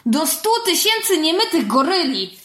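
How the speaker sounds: high, loud mock-cursing outburst; stamping anger. A narrator's reading. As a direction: swear comically with a stamp in the voice. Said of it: "tupnąłem". (gniewny okrzyk z tupnięciem)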